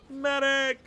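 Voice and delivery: loud, high battlefield cry for medic; man down shout. Pretend. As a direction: yell it like a soldier hit. Calling out like wounded soldier.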